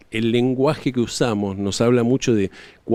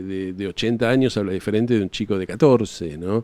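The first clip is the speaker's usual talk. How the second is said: ordinary talk, nothing marked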